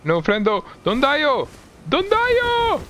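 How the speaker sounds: squeaky high falsetto wail; hammed-up cry for a dying friend; mock wailing.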